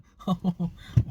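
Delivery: real chuckle at his own slip; self-conscious laugh. Real, not acted. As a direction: laugh at yourself briefly. Laughs at his own morning quarrel.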